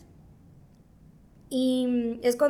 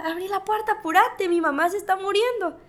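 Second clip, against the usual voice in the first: retold urgent cry; 6 semitones higher than usual. Daughter calling father as mother collapses, retold urgent cry. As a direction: recall an urgent call for help, pitch raised. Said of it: "le empecé a gritar a mi papá".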